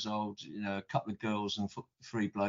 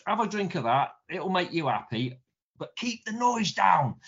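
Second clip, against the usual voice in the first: higher and louder, slowing right down on the warning; a gruff dad handing out cider, half-joking; mock stern Welsh miner; 5 semitones higher, 5 dB louder than usual. Drunk father pours kids cider.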